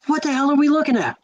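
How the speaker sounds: a high, quick, baffled exclamation, acted out; retold bewilderment. Teens fixated on a strange light in the sky, retold bewilderment. Stunned group stares at the object.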